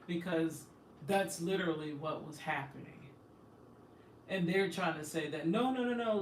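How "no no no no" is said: higher, louder string of noes; insistent mock denial. Mimicking officials trying to deny what happened, insistent mock denial. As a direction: mimic their hurried protest.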